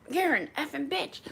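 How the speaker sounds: high-pitched mimicry at normal volume; nasty insult. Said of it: "she does this to me".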